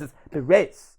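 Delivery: Perez in a put-on Spanish accent. Shown as a demonstration. Podcast guest mocking corrections of his Spanish, Spanish-accented Perez. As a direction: say "Perez" as a Spanish speaker would. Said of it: "say it in a Spanish accent".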